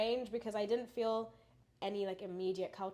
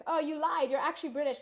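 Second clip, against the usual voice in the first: higher, louder accusing voice of other people; mocking other people; 7 semitones higher, 8 dB louder than usual. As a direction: play people catching her out, half teasing. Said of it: "everyone's like".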